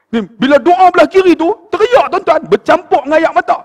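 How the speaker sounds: loud, high-pitched exhortation; impassioned preaching. Real, not acted. Preacher impassioned about tearful prayer.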